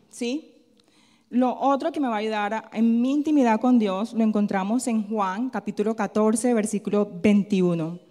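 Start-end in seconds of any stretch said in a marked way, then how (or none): none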